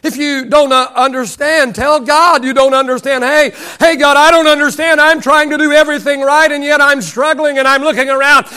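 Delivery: driving sermon with acted-out complaints to God; impassioned preaching. Real, not acted. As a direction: preach it hard, pleading the case to God. Preaches, voicing frank prayers.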